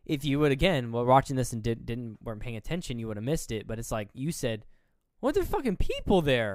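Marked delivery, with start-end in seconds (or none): 5.2-6.5 high, loud incredulous outburst; startled, voice jumping up; retold astonishment. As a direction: exclaim in re-enacted disbelief. Re-enacts shock at spotting people.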